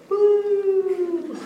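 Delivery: squealed high woo. Acted. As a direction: yelp it out high. Sound of a fall.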